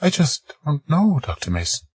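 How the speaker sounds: a hint of Margaret, worried; lightly voiced woman.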